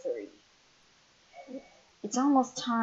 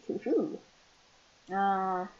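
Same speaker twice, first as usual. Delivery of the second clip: usual speaking voice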